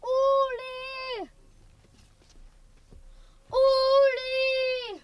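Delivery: high, carrying call of a name. Acted. Matthias calling across the snowy lot for Uli. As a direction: shout across the field to find him.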